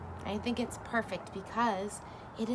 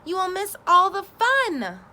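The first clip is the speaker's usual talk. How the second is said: loud, much higher plea; pleading cry; 9 semitones higher, 7 dB louder than usual. A narrator's reading. Frog pleading with sleepy Toad. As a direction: beg him not to miss the fun. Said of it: "cried Frog".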